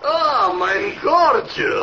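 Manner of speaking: high, slow, gushing cry of delight; gushing greeting. Acted. Sergei greets Peggy, high gushing delight. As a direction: swoon over her like a ham lover.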